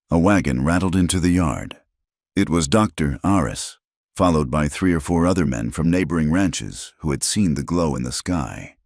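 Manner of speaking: plain reading voice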